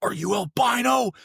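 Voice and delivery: high-pitched strained mock shout; imitated screaming. Instructors screaming at a pale trainee, imitated screaming. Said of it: "screaming at me".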